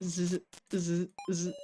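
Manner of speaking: soft, distant mock snore; sleepy snore under the breath; pretend snoring. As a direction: drift off into quiet snoring. Collapsed, hopeless, drifting off.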